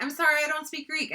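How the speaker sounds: put-on high, quick North Dakota accent; light imitation of a North Dakota accent. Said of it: "she said it with a super North Dakota accent".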